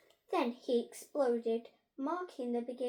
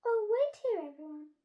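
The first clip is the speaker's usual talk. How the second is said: flustered teacher; 8 semitones higher, 6 dB louder than usual. A narrator's reading. Teacher hurrying.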